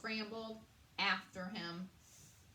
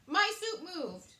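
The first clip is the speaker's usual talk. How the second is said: louder, higher, insisting; insistent queen; 8 semitones higher, 9 dB louder than usual. A narrator's reading. Queen to the chef, insisting her soup moved. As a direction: press the point, rattled. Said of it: "the queen said".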